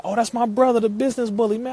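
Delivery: high, loud, delighted greeting; light up at a friend's name; delighted surprise. Real, not acted. Streamer spotting his brother in the chat, loud delighted surprise.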